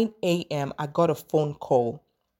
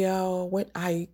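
ordinary talk, nothing marked

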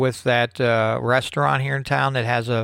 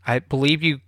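ordinary delivery

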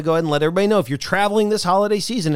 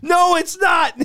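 indignant retort; 12 semitones higher, slower than usual. Retold or imitated. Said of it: "And I said".

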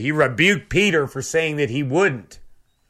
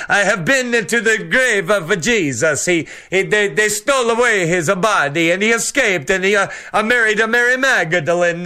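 a mock heavy Italian accent, pitched up a little; hammed-up Italian accent impression; 3 semitones higher than usual. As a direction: imitate a Vatican insider's heavy Italian accent. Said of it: "has a very heavy Italian accent. He talks, uh, like this".

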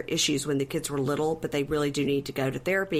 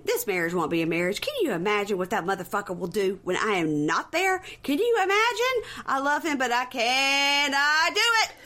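going off; 7 semitones higher than usual. Retold or imitated. Reality-show wife going off about her husband in the car, mock ranting. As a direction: work up into an over-the-top tirade. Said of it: "she's just going off".

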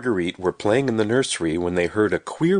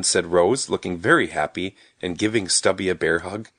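ordinary delivery, nothing marked

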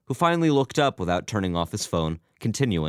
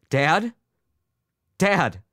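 raised, higher urgent call; calling out anxiously; 11 semitones higher, 5 dB louder than usual. A narrator's reading. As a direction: call louder for a response. Son calls to unresponsive father.

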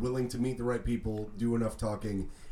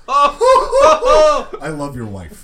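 much higher and louder, slow, laughing through it; laughing delight; 12 semitones higher, 12 dB louder, much slower than usual. Real, not acted. Cohost laughing hard at the wife's punchline. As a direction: crack up as you say it.

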